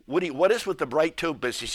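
usual speaking voice

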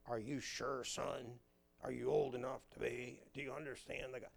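questioning pastor. Acted. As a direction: quiz a child in a low pastor voice. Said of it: "brings you to the pastor".

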